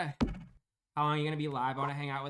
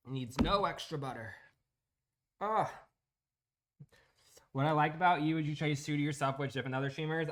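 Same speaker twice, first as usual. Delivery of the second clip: ordinary talk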